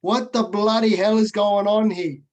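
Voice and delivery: hammed-up thick Italian accent, booming. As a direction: imitate an exasperated old Italian father's accent. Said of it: "with a, with a thick Italian accent".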